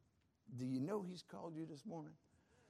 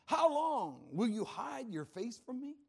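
higher, loud, drawn-out lament; David crying out; 5 semitones higher, 4 dB louder than usual. Acted. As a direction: plead forcefully with God as the psalmist. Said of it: "Can you imagine David saying that".

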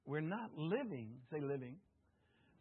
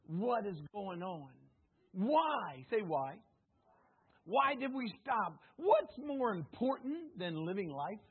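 normal talking voice

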